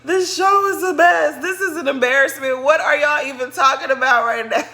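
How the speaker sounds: laughing through the words in disbelief. Real, not acted.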